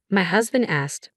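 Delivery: ordinary delivery, nothing marked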